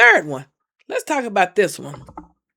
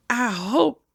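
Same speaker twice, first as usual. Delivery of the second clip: ordinary delivery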